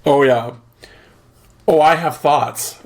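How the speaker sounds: ordinary delivery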